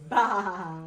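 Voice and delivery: put-on playful sheep baa for a toddler; sheep bleat. Mom imitates sheep for toddler.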